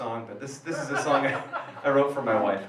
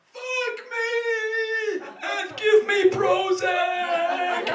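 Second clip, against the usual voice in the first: put-on shrieking high demon-girl voice, much higher, slowed on Prozac; possessed girl screaming; 4 dB louder, much slower than usual. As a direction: scream it like a possessed child writhing on the bed.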